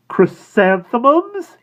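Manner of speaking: high, very slow question; drawn-out guess. A narrator's reading. Florist suggesting flowers, high and very slow. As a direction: offer it like a treat.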